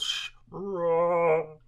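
a playful growled roar, half sung; Chewbacca roar. Retold or imitated. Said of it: "which is my Chewbacca impression".

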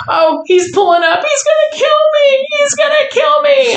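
high, rising mock wail; panicked niece. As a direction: imitate a terrified niece. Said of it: "And she's like".

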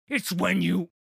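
a hint of an eager troll. A narrator's reading. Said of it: "said Trundle".

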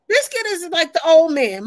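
ordinary talk

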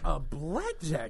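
slow, drawn-out disbelief; incredulous. Retold or imitated. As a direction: stretch it out like you can't believe it. Incredulous at weapon.

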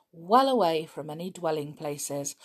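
ordinary delivery

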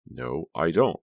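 ordinary delivery

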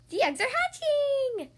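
high, slow, thrilled announcement; excited announcement. Acted. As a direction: sing it out with glee. Pig announces hatching.

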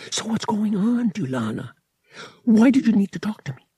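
high, breathy stage whisper; eager hushed questioning. A narrator's reading. Boy asking his night visitor for news, eager hushed voice. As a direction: press for news under your breath.

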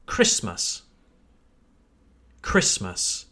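"Christmas" as KRISS-məs, low and falling; silent T. Shown as a demonstration. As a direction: model "Christmas" with no T sound. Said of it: "Listen to how I say it".

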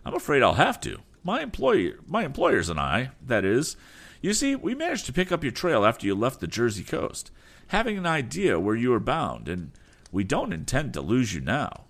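high, sneering rival voice; insolent reply. Acted. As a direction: taunt him with insolence. Berg's high villain voice continues.

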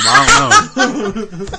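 high, slow, giggling through it; laughing. Real, not acted. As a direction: lose it laughing mid-line. Cracking up at joke.

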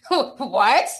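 imitated rapper's incredulous question. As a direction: ask it in mock disbelief, swooping upward. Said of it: "In my [name] voice".

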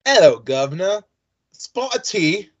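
stagey put-on English accent; mock English accent. Shown as a demonstration. Host tests whether they can still do it, mock English accent. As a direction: do a hammed-up English greeting. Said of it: "see if I can still do this".

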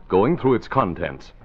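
ordinary talk

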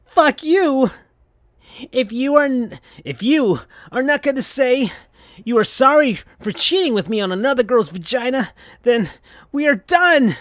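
Sonic's full-rage breakup yell; shouted. Acted. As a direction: blow up and break it off. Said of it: "shouted Sonic".

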